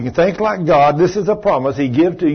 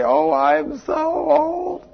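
much higher, slow, exaggerated whine; hammed-up lament; 12 semitones higher, much slower than usual. Preacher mocking a woman's lament about age, hammed-up whine. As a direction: wail it like a melodrama. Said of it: "I hear people talking like that".

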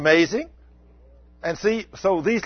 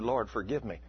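imitated low, soft prayer; quiet penitent plea; 5 semitones lower, 10 dB quieter than usual. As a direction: murmur a humble plea for forgiveness. Retells girl's penitent prayer.

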